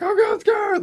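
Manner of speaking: very high and loud frightened whine; imitated frightened cry; mock scared squeal. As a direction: squeal it in hammed-up panic. Sister losing it, frightened.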